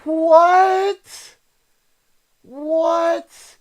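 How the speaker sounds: high, stunned repeated what; shocked disbelief. Real, not acted. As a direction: blurt it out in disbelief. Reacting to donation.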